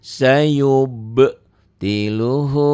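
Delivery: slow tajwid chant; Quran recitation. Shown as a demonstration. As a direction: draw the words out like a reciter. Recitation example. (lantunan tartil pelan)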